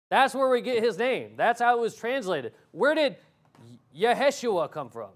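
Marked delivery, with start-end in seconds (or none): none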